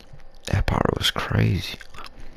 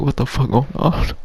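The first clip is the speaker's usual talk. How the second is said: stunned question; 9 semitones higher than usual. Retold or imitated. Shocked at the party scene.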